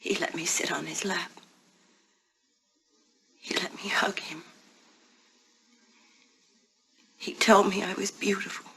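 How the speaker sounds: whispering. Acted. Confessing a secret in whispers.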